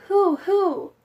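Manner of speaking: high owl hoot. A narrator's reading. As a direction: call out like an owl at night. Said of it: "yelling out".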